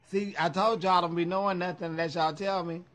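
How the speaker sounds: ordinary talk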